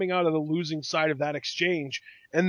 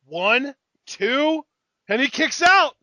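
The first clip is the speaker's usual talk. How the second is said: excited count; 7 semitones higher, 7 dB louder, slower than usual. Real, not acted. Counting a near-fall live.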